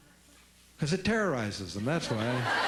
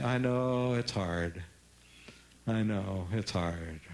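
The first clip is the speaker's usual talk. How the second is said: softened; 8 semitones lower, much slower than usual. Shown as a demonstration. Parent softening a reply to a pleading kid, low and gentle. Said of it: "if you want to soften it".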